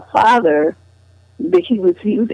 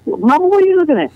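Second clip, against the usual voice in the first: higher, fast, quick puzzled question to mama; a teen girl half-laughing at her mother; puzzled teenage daughter; 10 semitones higher, much faster than usual. Retold or imitated.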